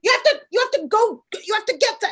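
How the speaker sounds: high, loud panicked commands; husband yelling at his wife to go get help; frantic orders. Retold or imitated. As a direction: urge her to go, nearly yelling. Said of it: "He goes".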